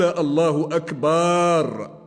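last syllable of "أكبر" drawn out very long; lengthened baa in akbar. Shown as a demonstration. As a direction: stretch "أكبر" into a slow "akbaaar". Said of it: "مد الباء في أكبر".

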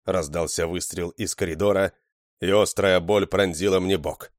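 usual speaking voice

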